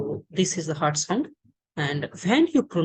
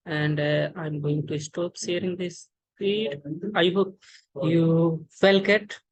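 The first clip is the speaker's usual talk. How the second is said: ordinary talk, nothing marked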